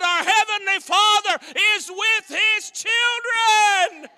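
full-force preaching. Acted. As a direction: hammer it home, shouting to the congregation.

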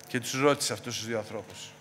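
ordinary delivery

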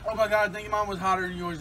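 ordinary delivery

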